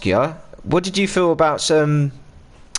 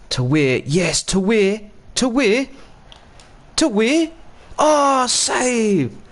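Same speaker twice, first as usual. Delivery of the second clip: climbing higher with each "to Weir"; live match excitement; 8 semitones higher, much slower than usual. Real, not acted. Fan yelling at the telly as the attack builds, excited. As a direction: urge the pass on, rising to a shout.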